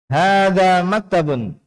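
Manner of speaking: "Hadza maktabun" high and slow, "Hadza" held long, falling at the end; model statement intonation. Shown as a demonstration. Teacher reads for the class to follow, drawn-out statement intonation. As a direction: draw out "Hadza maktabun" high, then let it fall. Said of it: "Perhatikan panjang pendeknya dan intonasinya".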